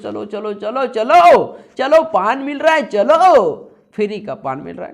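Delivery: loud, high rallying shout, drawn out; excited crowd call. Acted. भीड़ को पान के लिए पुकारना. (जोश में भीड़ को पुकारते हुए ज़ोर की आवाज़)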